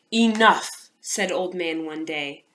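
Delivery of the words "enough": put-on booming old man command; loud. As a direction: call out to silence the noisy crowd. Old Man demands quiet.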